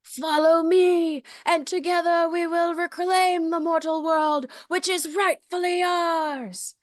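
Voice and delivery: high, grandiose villain rallying cry; hammed-up warlock proclamation, pitched well up; Calabar. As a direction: summon your followers in a stretched, theatrical voice. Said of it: "So that's his speech".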